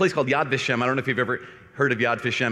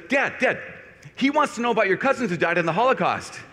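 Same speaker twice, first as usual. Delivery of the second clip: higher, lightly voiced eager kid; kid calling Dad; 7 semitones higher than usual. A teenage son telling his dad about a stranger, lightly imitated kid calling out. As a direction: imitate a boy calling over to his father.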